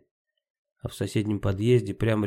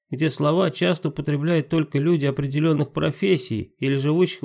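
pixie; 5 semitones higher, 4 dB louder than usual. Acted. Timka reports papa's explanation.

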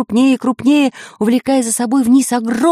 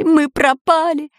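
higher, slower panicky wail; panicked cry; 7 semitones higher, slower than usual. A narrator's reading. Robbers trapped in dark cave, panicked wail. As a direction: cry out that all is lost. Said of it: "заметались по пещере разбойники".

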